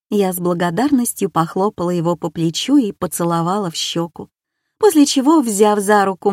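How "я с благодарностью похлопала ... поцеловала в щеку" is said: ordinary delivery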